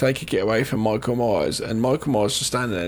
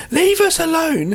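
high, raised-voice mock plea; teacher's telling-off; 6 dB louder than usual. Girl turns around on the stairs to Michael, teacher-like high call. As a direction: imitate a teacher calling out, pitched right up. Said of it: "Almost like a teacher".

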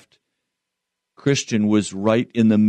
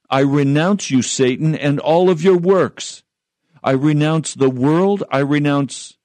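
raised pitch, emphatic preacher's defiance; defiant renunciation; 5 semitones higher than usual. Acted. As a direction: cast off the devil, each clause driven home. Models defiant renouncing of devil.

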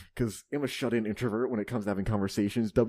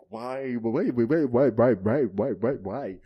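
anguished repeated why; 6 dB louder, slower than usual. Acted. Dramatic poem reading, repeated why.

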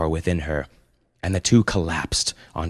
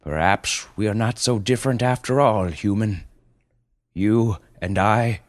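solemn panda; 3 semitones higher than usual. Acted. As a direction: speak as a wise beast, very deliberately. Panda speaks gravely to mother.

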